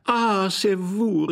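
high, loud, drawn-out delight; joyful cry. A narrator's reading. Glafira rushes to her husband, crying out joyfully. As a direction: cry out in joy, stretching the words. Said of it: "mit ungefälschter Freude".

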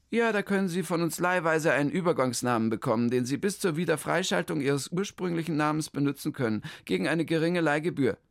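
high, chirpy clerk voice; the clerk rattles off her greeting, high; official lady. Acted. The town-hall clerk greets Hermann, high and chirpy.

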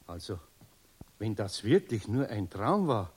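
ordinary delivery

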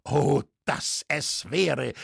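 slow, yearning cry; fervent exclamation. A narrator's reading. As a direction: draw it out like a plea to heaven. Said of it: "rief Lüskirchner". (inbrünstiger, gedehnter Ausruf)

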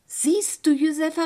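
exclaimed quote, lifted in pitch and volume; retold calling out. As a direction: call it out in retelling. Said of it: "rief ich".

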